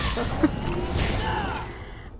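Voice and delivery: squeaky laugh. Real, not acted. Player laughs at own choice.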